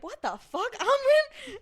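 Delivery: high, loud yelp of disbelief; shocked outburst. Retold or imitated. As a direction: shout her name in shock. Everyone shocked at Amren.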